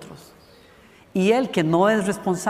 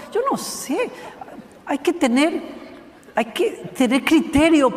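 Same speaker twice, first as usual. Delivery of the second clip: laughing; 7 semitones higher than usual. Real, not acted. Mocking youth fashions.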